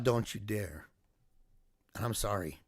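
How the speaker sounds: low, soft and pained; quiet plea to God. Retold or imitated. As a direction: hush it, like a prayer through tears. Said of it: "I told God".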